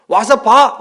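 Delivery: loud, lifted invitation; Jesus inviting. Retold or imitated. Jesus invites the disciples, loud and lifted. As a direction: boom out the welcome.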